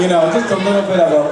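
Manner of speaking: ordinary talk, nothing marked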